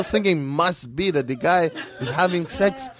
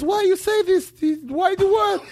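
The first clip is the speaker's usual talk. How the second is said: 1 shocked doctor; 14 semitones higher than usual. Retold or imitated. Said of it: "So he goes".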